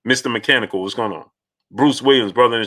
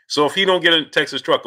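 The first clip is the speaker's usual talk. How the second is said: ordinary talk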